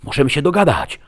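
high, loud shouted offer; urgent, high-pitched shout; pleading shout. A narrator's reading. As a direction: cry out to strike a bargain. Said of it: "krzyknął Merid".

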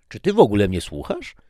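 eager lecture. Acted. Husband enthusing to wife about physics episode, eager. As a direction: rush on about your next topic.

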